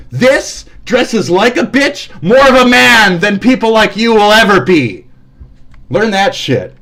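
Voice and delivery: real defiant anger; defiant put-down. Real, not acted. Defiant boast at opponent.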